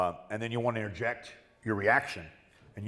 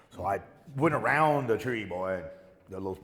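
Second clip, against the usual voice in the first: ordinary talk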